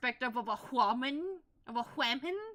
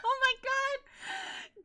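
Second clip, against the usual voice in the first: slow, high teary wail through laughter; overwhelmed by laughter at the scene; cry-laughing; 14 semitones higher, much slower than usual. Real, not acted. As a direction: stretch it out while laughing to tears. Overcome laughing.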